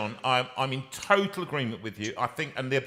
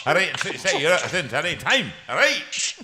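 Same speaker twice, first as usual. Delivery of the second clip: mock Scottish accent; 3 semitones higher, 4 dB louder than usual. Host mimicking his Scottish guest on the phone, mock Scottish accent. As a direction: put on a chirpy Scottish phone voice. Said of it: "and speak like that".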